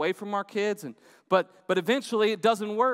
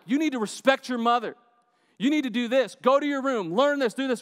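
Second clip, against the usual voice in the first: louder, higher, building scolding commands; stern lecturing dad; 6 semitones higher, 3 dB louder than usual. Pretend. Dad scolding his son for disrespecting mom, building stern rebuke. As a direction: bark orders at the kid, getting harsher. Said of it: "I could have been like".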